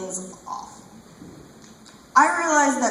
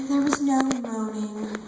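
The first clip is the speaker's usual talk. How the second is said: usual speaking voice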